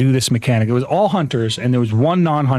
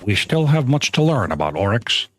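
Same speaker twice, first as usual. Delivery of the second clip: lightly lowered, solemn NPC delivery; a hint of a grave lore-keeper; game NPC voice line; 5 semitones lower than usual. Shown as a demonstration. Doing the Cryptarch's voice line for the chat, lightly solemn and lower. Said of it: "I'm gonna do the voice line".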